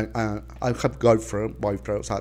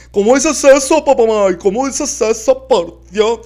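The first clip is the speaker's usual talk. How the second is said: outraged; 10 semitones higher, 7 dB louder than usual. Acted. Aunt outraged.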